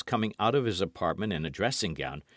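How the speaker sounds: ordinary delivery, nothing marked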